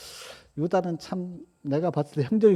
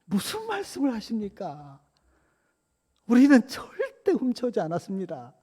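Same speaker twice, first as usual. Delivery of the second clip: high-pitched acted denial; taken aback, pleading innocence in a higher voice; startled protest; 6 semitones higher than usual. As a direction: protest innocence in shocked disbelief, pitch raised. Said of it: "형들이 놀라죠".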